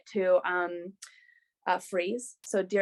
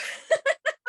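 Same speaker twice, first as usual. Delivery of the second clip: high laugh; 3 dB louder than usual. Real, not acted. Laughing at her own bathroom story, high laugh.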